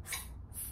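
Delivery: hissed "S, S, S" unvoiced like a snake; s sound. Shown as a demonstration. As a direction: stretch each "S" into a hiss. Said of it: "S is for snake".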